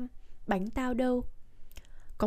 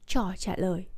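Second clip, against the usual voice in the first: usual speaking voice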